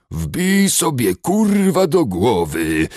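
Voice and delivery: slow, loud, high snarling dwarf; rasping dwarf rage. A narrator's reading. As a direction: spit it out in a furious rasp. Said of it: "harczało". (charczący, wściekły krasnolud)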